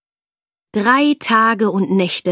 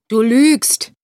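blustering; 3 semitones higher, 8 dB louder than usual. Acted. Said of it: "polterte der".

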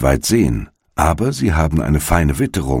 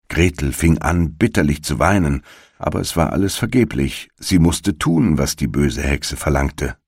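ordinary delivery

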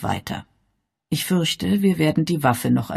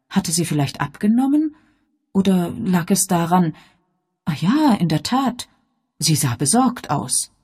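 plain reading voice

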